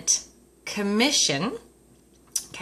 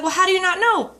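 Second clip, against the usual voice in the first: high, pointed disbelief; husband pointing out she wrote the slips, high and incredulous; 9 semitones higher than usual. Retold or imitated. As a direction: press the obvious point. Back-and-forth with husband.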